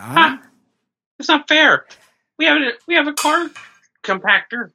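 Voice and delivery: high, whining protest in character; put-on high voice, complaining it's unfair; Stephen Avery. Host mimics accused man pleading unfairness, high-pitched. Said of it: "Stephen Avery".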